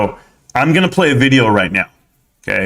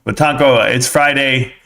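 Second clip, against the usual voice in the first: usual speaking voice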